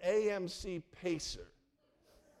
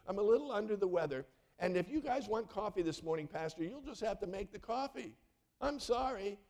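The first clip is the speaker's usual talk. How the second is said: imitating an apologetic churchwoman in a higher voice; lightly lilting, higher-pitched phone voice; winsome, upbeat; 5 semitones higher than usual. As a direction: play her sweetly sorry, pitched up. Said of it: "she was sounding winsome and upbeat".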